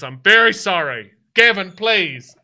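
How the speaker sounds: loud, exasperated pleading; apologise, then implore him to stop; weary reproach; apologetic bartender. Acted.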